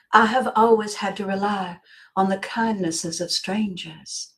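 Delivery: put-on a light Southern Belle accent, sweetly helpless. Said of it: "she says in a Southern Belle accent".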